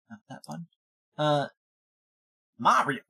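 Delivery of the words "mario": ordinary talk, nothing marked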